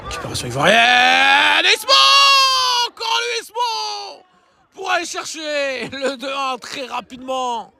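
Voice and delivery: high shouted goal call; excited sports commentary. Acted. Second goal called live. (commentaire sportif survolté)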